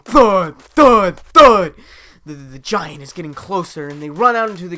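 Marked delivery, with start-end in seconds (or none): 0.0-1.7 each thud louder than the last; growing thuds. A narrator's reading. Narrator booming the giant's steps as it nears. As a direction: pound out the approaching footsteps.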